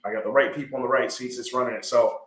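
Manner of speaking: normal talking voice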